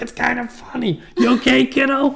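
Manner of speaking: high-pitched mock-jovial dad; amused dad impression. Dad checking on his hit son, high and jovial. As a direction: play a dad making light of it. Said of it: "And he's like".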